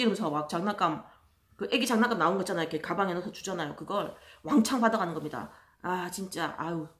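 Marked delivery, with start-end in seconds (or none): none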